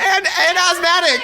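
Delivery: high, loud squeal through laughter; laughing protest. Real, not acted. Actor adding to teased list of ailments, laughing protest. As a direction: blurt it out while cracking up.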